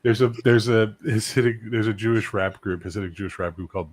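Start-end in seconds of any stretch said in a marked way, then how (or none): none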